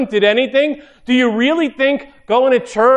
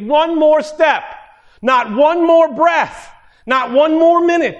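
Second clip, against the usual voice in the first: preaching at full force; 4 semitones higher, 3 dB louder than usual. Acted. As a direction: build the congregation up, pressing each phrase. Preaching builds to climax.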